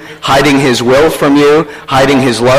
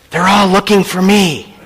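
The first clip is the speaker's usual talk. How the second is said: put-on higher little-boy voice for the punchline; whispering small child; 5 semitones higher than usual. Small boy revealing why everyone is busy, hushed higher child voice. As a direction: land it as an innocent small child. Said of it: "a small voice whispered".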